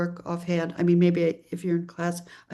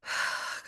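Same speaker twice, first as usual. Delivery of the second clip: deep sigh for air; 8 dB quieter than usual. Real, not acted. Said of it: "gotta get some oxygen in the lungs".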